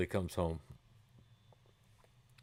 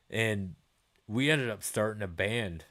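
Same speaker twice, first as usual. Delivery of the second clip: usual speaking voice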